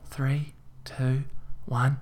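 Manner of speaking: whispered countdown; counting silently. A narrator's reading. Emily counting to herself before her song. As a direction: whisper the count under your breath. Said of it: "counting silently to herself".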